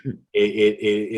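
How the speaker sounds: ordinary talk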